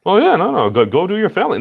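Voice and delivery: high, bright, eager send-off; excited. Real, not acted. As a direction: shoot the voice up, all eager. Friend leaving, eager send-off.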